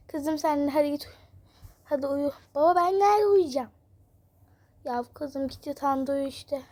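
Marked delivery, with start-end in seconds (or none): 2.5-3.7 put-on higher, louder small girl's whine; little girl voice. Daughter asking her dad where to sleep, small girl voice.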